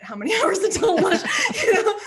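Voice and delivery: retold question breaking into laughter, high and loud; laughing. Producer mocking her between-takes clock-watching, laughing. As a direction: crack up while imitating yourself.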